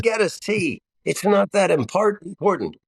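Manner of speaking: high, light repeated reassurance; mock dad advice. Father telling his daughters again, high, playful.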